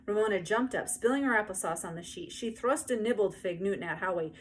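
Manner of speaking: ordinary delivery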